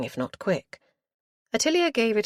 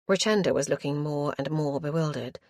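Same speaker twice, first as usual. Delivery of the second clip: ordinary delivery, nothing marked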